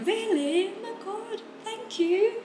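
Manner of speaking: very high gushing British "thank you"; high put-on British gush; British accent, gushing thanks. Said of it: "pakai British accent".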